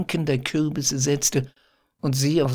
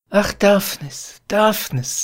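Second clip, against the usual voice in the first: higher, tender cry of happiness; tender joy; 6 semitones higher, 4 dB louder than usual. A narrator's reading. Phyllis to Daphnis, overflowing with tender joy. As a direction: Phyllis sighs his name, full of tender joy. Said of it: "voll der zärtlichsten Freude".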